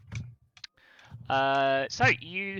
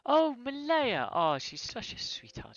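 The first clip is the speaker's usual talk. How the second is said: fond gushing; 8 semitones higher than usual. Acted. Healer speaking of the little girl helping her, gushing fondly. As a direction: gush over a beloved child.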